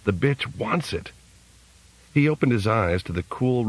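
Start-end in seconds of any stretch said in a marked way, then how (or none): none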